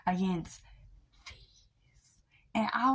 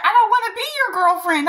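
higher, louder and fast, snapping back; high indignant retort; 10 semitones higher, 9 dB louder, much faster than usual. Retold or imitated. Quoting her own comeback to the boy, high fast outburst. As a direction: fire it right back at him, high and loud. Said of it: "I was like".

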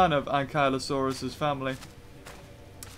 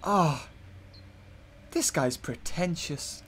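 muttered thought; 3 semitones lower, 10 dB quieter, slower than usual. Retold or imitated. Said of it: "I was just like".

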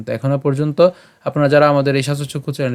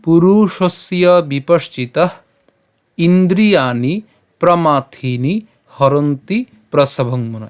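slow, careful shloka reading; measured teaching recitation; Sanskrit verse recitation; 3 semitones higher, 3 dB louder, much slower than usual. Shown as a demonstration. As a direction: recite syllable by syllable, long vowels held.

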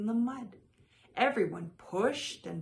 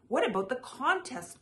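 calling out; 6 semitones higher than usual. A narrator's reading. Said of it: "called another voice".

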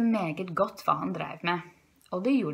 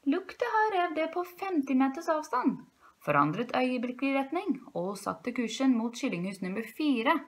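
plain reading voice

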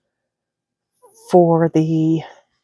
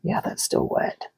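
whispering; 9 dB quieter than usual. Real, not acted. Quiet aside while checking.

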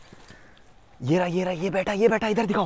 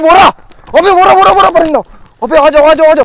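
loud, high burst of excitement on spotting the bird; excited shout; 13 semitones higher, 15 dB louder than usual. Real, not acted. Vlogger spots the hidden bird in the bushes and shouts with joy. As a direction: shout it out, thrilled to have found it.

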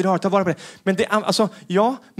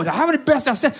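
put-on higher, thrilled teen voice; higher, gushing daughter; 9 semitones higher than usual. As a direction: gush like a wowed teen.

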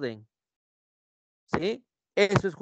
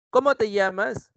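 raised pitch on CÓMO, asking; questioning; 5 semitones higher, 4 dB louder than usual. Shown as a demonstration. Teacher acts him asking her name, questioning tone. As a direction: ask it with CÓMO pitched high. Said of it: "Le pregunta".

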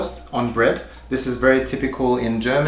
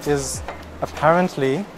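muffled behind mask; speaking masked in store; through a face mask; 3 semitones higher than usual. Real, not acted.

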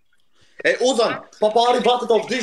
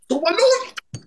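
calling out; 13 semitones higher, much slower than usual. Real, not acted. Calling out impatiently.

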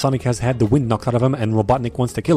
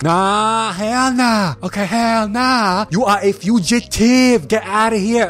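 high, slow, sassy put-on woman's voice; sassy sister-in-law; 7 semitones higher, much slower than usual. Sister-in-law turning the fugitive away, high sassy drawl. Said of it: "his sister-in-law hates him like".

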